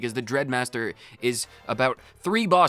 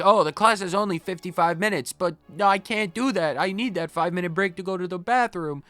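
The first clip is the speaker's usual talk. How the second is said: high, mocking whiny student voice; mock a whining student, pitched up; 7 semitones higher than usual. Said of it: "It's like".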